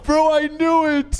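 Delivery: high, loud gleeful I-knew-it cry. Real, not acted. Streamer crowing as the cheating story is revealed, high and gleeful. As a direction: crow triumphantly at being right.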